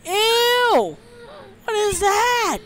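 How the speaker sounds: high, loud grossed-out yelps; very high cries of shock; shrieked disgust. Real, not acted. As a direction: squeal in revolted disbelief. Repulsed by what appears.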